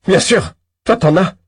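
a sour, biting shout, loud and high; acid, sneering call; acid retort. Acted. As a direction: spit the jab back at the rich doctor. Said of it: "réplique-t-il d'une voix acide".

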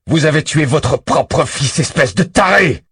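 cri furieux, plus aigu et plus fort, lent au début; cri de rage. Acted. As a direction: hurle l'accusation à travers la grille. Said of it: "secoue la grille avec violence". (il hurle au tueur à travers la grille, fou de rage)

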